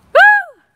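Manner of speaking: sudden high, loud whoop; loud whoop at the dog. Real, not acted. Whooping loudly to get the dog's attention. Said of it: "the dog got confused, so I had to make a noise".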